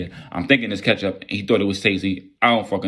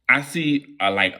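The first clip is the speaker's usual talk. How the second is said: ordinary delivery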